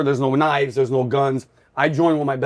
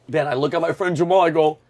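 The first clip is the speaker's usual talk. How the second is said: ordinary talk, nothing marked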